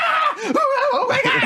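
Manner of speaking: very high mock scream; panicked outburst. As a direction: go from quiet guy to hysterical shrieking. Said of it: "I would go from quiet [name]".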